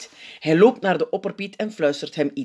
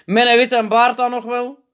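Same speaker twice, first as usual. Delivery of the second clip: higher, louder testy jab; korzelig; 8 semitones higher, 6 dB louder than usual. A narrator's reading. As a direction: add it with irritation. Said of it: "vraagt hij korzelig". (korzelig, sarcastisch)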